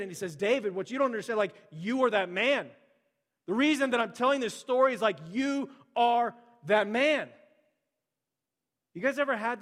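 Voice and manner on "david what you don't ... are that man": loud, high, slowing accusation; dramatic accusation. Acted. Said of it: "the mic drop moment".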